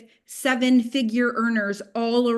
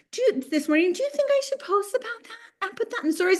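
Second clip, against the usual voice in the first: much higher, hesitant asking voice; timid team member; 11 semitones higher than usual. Retold or imitated. Team member asking if she should post, high and unsure. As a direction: ask for permission, unsure. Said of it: "they're like".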